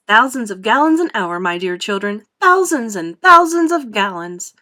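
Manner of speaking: loud, animated boasting with big emphasis; proud factory owner in full flow; Wonka, exuberant showman. Acted. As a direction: show off your wonder with swelling excitement. Voices Wonka showing off.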